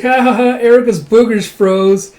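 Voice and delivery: high, loud giggly taunt, slowing; laughing kid tease. Acted. Yoko laughing at Erica, high giggling tease. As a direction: tease her while laughing.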